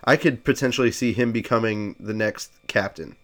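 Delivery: ordinary talk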